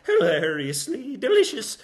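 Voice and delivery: light put-on Scottish brogue; hammy Scottish lilt; mock Scottish accent. Presenter mocking bad accents, in a mock Scottish accent. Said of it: "wee barley voices".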